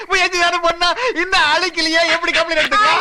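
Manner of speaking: high laugh spilling into words; the kidnapper laughs off her threat of a police complaint, amused and high. Acted. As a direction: scoff with a laugh.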